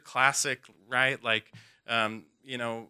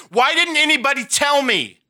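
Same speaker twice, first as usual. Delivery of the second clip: much higher, much louder desperate yelling; panicked screaming; 11 semitones higher, 14 dB louder than usual. Retold or imitated. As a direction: scream it out in blind panic and blame. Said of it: "screaming and yelling".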